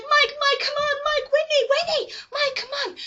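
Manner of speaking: high-pitched mock panic; frantic high cries of names; panicked calling. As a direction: urge friends to flee, hammed up. Panicked urging acted out.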